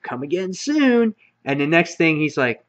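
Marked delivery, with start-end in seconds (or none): 0.0-1.1 lightly voiced sly villain, higher; a hint of a smug farewell from the cage; teasing send-off in a raised, put-on voice; mocking goodbye. Negan taunting in comic retelling.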